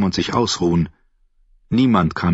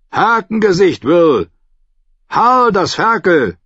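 higher and louder, hands cupped round the mouth; calling up; 11 semitones higher, 5 dB louder than usual. Acted. Davos calls up to the guard on the wall. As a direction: call up to the gate.

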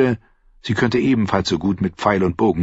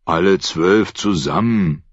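usual speaking voice

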